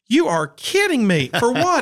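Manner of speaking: you can't be serious; stunned disbelief. Retold or imitated. Told on the phone of the nomination, stunned high disbelief. Reacting to Grammy nomination call.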